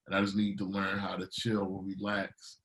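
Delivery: usual speaking voice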